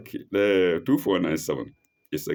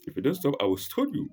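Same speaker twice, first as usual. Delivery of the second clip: quick, higher-pitched mock warning; mock threat; 7 semitones higher, faster than usual. Father threatens Abraham in paraphrase, hurried mock threat. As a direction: rattle off a threat in jest.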